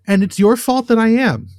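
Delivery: high-pitched, exasperated scolding; mock blaming. Real, not acted. Scolding co-host over unwanted thought.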